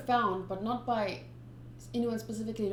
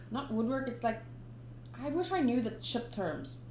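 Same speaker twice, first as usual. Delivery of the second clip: ordinary delivery, nothing marked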